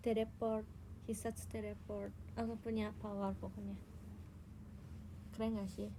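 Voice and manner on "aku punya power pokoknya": ordinary talk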